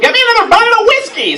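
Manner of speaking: high, fast bossy demand; bossy adult. Retold or imitated. Host mocking a bossy adult for laughs. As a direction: snap it out like a bossy grown-up. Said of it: "something bossy".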